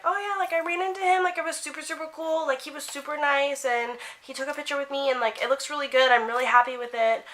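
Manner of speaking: high-pitched, breezy fan chatter; imitated giddy gushing. Re-enacting telling her friend about the meeting, high giddy gushing.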